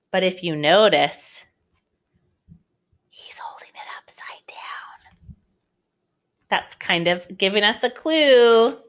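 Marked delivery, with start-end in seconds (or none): 3.1-5.4 whispered aside. Real, not acted. Teacher tells the children a hidden clue, whispering.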